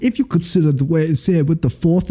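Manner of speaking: hammed-up old British English; mock Anglo-Saxon English. Co-host faking strong English, mock British accent. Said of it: "like Anglo-Saxon English".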